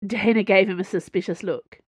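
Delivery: ordinary delivery, nothing marked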